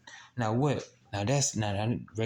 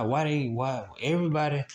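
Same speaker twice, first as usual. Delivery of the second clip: normal talking voice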